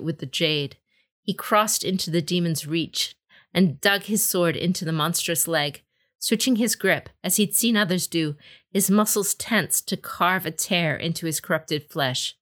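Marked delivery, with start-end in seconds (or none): none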